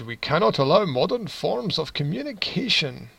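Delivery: put-on light Scottish accent on the prime minister's quote. Vlogger quoting the prime minister, in a light Scottish accent. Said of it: "Why am I giving him a Scottish accent?".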